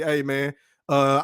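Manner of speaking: ordinary delivery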